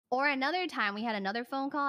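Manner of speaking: normal talking voice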